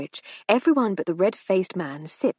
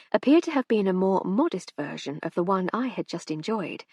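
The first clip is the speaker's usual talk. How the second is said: usual speaking voice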